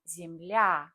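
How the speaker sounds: земля said zem-LYA, stress on the final ya; zemLYA, lean on the ending. Shown as a demonstration. Teacher shows learners: земля stressed on the ending. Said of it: "would have stress there".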